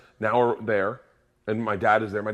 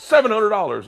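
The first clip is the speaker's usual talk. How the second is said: much higher, louder mock cop; angry cop; 10 semitones higher, 8 dB louder than usual. Cop naming the fine, hammed-up angry. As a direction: announce the fine like an irate cop. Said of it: "The cop came up very angry".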